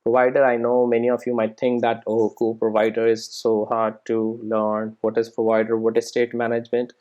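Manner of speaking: ordinary talk, nothing marked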